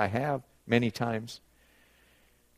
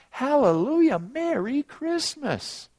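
high, drawn-out festive exclamation; celebratory cry; 12 semitones higher, slower than usual. Real, not acted. Preacher celebrating Christmas with the congregation, elated and high. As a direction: sing out the greeting, slow and elated.

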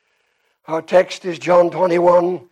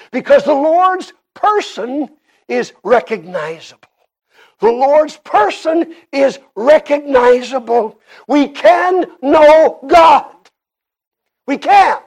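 emphatic preaching; 7 semitones higher, 7 dB louder than usual. Acted. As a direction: build to a raised, higher pulpit voice. Preaches at full force.

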